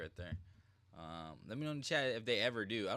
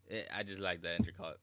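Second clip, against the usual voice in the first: usual speaking voice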